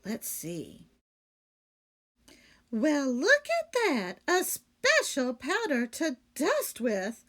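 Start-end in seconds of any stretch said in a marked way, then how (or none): 2.7-7.2 slow, louder, higher delight; delighted exclaim. A narrator's reading. Maid delighted at finding dusting powder, louder. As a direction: exclaim over a wonderful discovery. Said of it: "exclaimed Amelia Bedelia".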